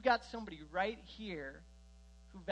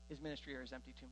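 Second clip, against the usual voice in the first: softer, quick repeat of the point; quiet fast restatement; 5 semitones lower, 9 dB quieter, much faster than usual. Shown as a demonstration. Preacher repeating the claim to the congregation, softer and fast. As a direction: drop the voice and hurry through it. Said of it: "Did you know that?".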